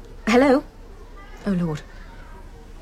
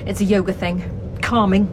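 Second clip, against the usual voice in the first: ordinary delivery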